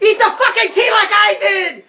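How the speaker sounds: high, loud shouted order with laughter near; in-character yell, way up high. Acted. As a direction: holler a desperate command. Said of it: "I yell out".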